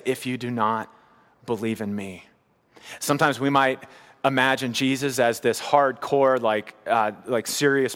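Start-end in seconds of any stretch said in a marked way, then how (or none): 0.0-2.3 softer, tender warning; soft and tender, quieter and trailing down; gentle, tender. Shown as a demonstration. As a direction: say it gently, like a plea from someone who cares. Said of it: "I wonder the tone of voice Jesus used".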